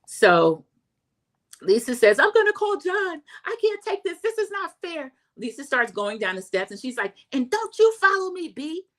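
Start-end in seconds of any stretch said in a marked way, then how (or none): 2.2-5.1 very high, wailing mimicry; upset Lisa. Vlogger mimics Lisa storming off, very high and whiny. 7.3-8.9 high, snapped mimicry; angry warning. Vlogger mimics Lisa warning Heather off, high.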